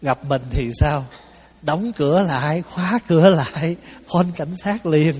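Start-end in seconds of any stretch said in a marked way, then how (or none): 1.6-5.1 loud, emphatic mock-urgent commands; comic show of raising the alarm; urgent acted alarm. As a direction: rap out each order louder, playing alarmed. Acting out own panicked reaction to thief.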